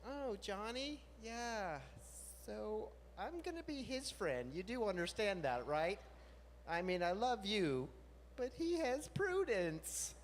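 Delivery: choosing sides. Retold or imitated. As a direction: let them down gently. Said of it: "So who gets Prudence?".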